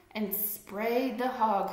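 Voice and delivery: ordinary delivery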